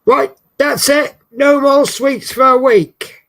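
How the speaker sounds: high, loud, slow mock scold. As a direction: tell yourself off in jest. Mock telling off herself.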